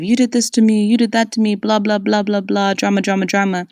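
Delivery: singsong finger-pointing whine; mocking accusation. Mocking an ex's accusations in a singsong whine. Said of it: "finger pointing of".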